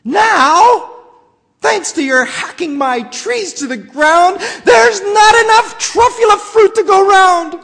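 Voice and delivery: high, loud, indignant tirade; snapping Lorax. Acted. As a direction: scold the Onceler hard. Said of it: "He snapped".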